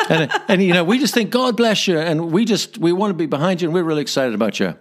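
a hammed-up American accent, mock-gushing; bad American accent. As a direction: play the gushing American caller on the answering machine with a bad American accent. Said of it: "forgive my bad American accent here".

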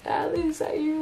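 light up as you spot her; excited greeting. Retold or imitated. Grateful stranger spots the narrator again, excitedly. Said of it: "she's all excited".

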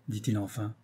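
plain reading voice

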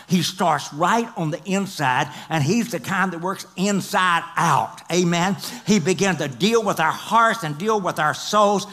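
ordinary talk, nothing marked

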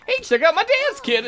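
very high, loud showing-off voice; silly mascot voice. Retold or imitated. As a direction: brag like a goofy dancing mascot. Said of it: "I like how it's like".

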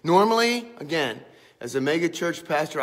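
usual speaking voice

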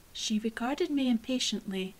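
ordinary delivery

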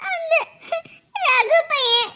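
sobbing complaint to mother; halting, tearful report; crying. Acted. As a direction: tell on him between sobs. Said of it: "என்னடி அழுவுற".

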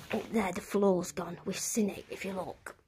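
ordinary delivery

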